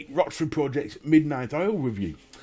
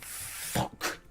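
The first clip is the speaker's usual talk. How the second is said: breathy whispered swear under the breath; whispered curse; 12 dB quieter than usual. Retold or imitated. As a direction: mouth the curse almost silently. Muttered reaction to chat names.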